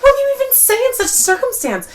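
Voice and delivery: high, fast and loud, at wit's end; a flustered high-pitched rant; exasperated high squeal. Retold or imitated. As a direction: squeal it out in mock panic. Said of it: "But I'm like".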